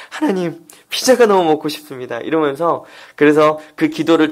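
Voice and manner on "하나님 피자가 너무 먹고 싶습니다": ordinary delivery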